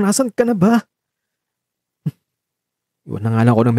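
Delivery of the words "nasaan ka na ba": worried. Acted. Worried about missing friend.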